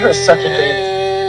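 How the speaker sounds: high, slow, tearful insult; crying. Real, not acted. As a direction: sob the words out in pain. Crying out while being restrained.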